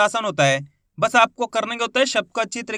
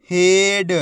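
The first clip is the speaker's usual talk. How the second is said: whole word in one joined, drawn-out piece; teacher's blended model reading; syllables blended; 5 dB louder, much slower than usual. Shown as a demonstration. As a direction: join the sounds into one loud, clear word. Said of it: "आपस में मिला के पढ़ना है".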